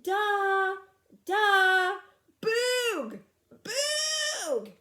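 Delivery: loud high repeated calls; yelling. Acted. Fred keeps yelling for the cavemen, loud high calls. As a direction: keep yelling, long and high. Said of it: "yelled Fred".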